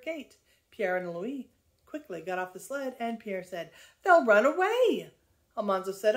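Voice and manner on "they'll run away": loud, high warning cry; alarmed warning. A narrator's reading. Pierre warns of runaway calves.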